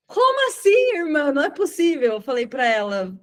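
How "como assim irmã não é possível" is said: high, astonished "como assim"; shocked disbelief. Retold or imitated. Preacher to the unmarried woman, in shocked disbelief. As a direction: gasp it out in sheer disbelief.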